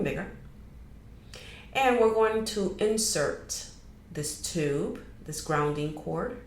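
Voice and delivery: measured guiding voice with long pauses; guided meditation. Acted. Host guiding listeners to release energetic clutter, guided meditation. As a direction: lead them unhurriedly through a grounding meditation.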